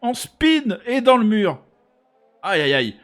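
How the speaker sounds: animated race commentary on the replay; motorsport commentator. Real, not acted. (commentateur sport auto animé)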